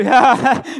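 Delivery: loud burst of giggling; child's giggle. Pretend. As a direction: laugh it off like a cheeky kid. Child laughing at parent.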